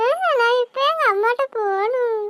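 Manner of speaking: put-on high child voice, sniffling; frightened little girl. Girl insists on going home.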